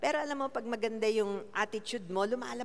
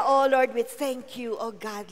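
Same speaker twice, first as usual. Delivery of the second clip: normal talking voice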